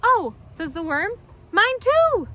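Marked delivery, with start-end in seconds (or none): 0.0-0.3 surprised. Acted. Worm's surprised reply. 1.5-2.3 high, loud and eager; squeaky proud worm, much higher and louder; excited worm. Acted. Worm's eager reply.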